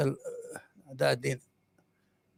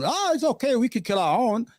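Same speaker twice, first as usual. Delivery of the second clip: high, breezy mock dismissal, Oh pitched up; mock indifference; 6 semitones higher than usual. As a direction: shrug it off in a sarcastic voice. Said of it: "mocking Israel's stance".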